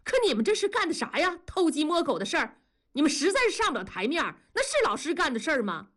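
high, fast shrill accusation; neighbour's wife accusing the teachers, high and fast. A narrator's reading. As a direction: rise up and pile on the blame fast. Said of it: "声音突然就拔高了".

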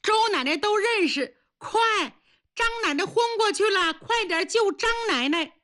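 urgent call. Acted. Neighbours rush to fainted grandma.

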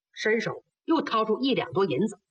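plain reading voice

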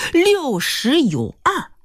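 六十有二 stretched out slowly, pitched high; slow, deliberate. Retold or imitated. Suitor repeating the boss's age when asked again, slow and deliberate. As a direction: spell out 六十有二 word by word with mock formality. 追问后一字一顿地重答.

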